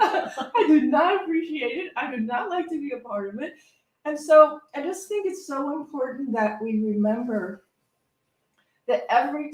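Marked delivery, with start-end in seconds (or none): 0.0-3.5 laughing retort. Real, not acted. Laughing retort, gossip story.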